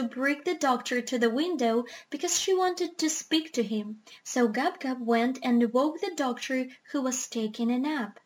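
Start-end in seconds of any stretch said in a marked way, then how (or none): none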